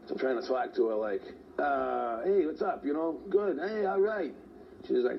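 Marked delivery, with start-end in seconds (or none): 1.6-4.3 breezy, higher fake cheer; fake casual. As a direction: cover it up with a light, bright voice. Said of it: "I want him to think I'm talking to my buddies".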